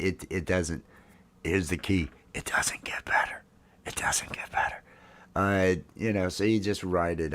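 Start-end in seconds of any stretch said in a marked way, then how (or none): 2.3-4.8 whispered Australian-free aside, breath only, no voice; a hushed, mock-ominous whisper; mock secret whisper. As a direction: let it slip out as a grim secret, whispered. Joking warning about aging.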